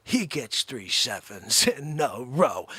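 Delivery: normal talking voice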